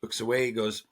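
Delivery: ordinary talk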